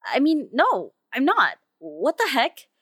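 loud, high burst of objection; indignant protest. Acted. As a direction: catch yourself and protest loudly. Catches themself obeying, objects.

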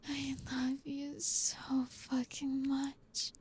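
normal talking voice

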